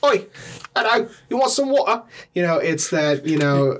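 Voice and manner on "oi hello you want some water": high, cheeky Cockney impression; Cockney accent. Shown as a demonstration. Demonstrating London accents to a co-host, high Cockney. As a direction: offer it in high-pitched Cockney. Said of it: "I find the Cockney one way more interesting".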